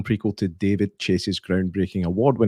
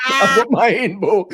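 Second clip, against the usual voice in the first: laughing; 11 semitones higher, 4 dB louder than usual. Retold or imitated. Retelling pun while laughing.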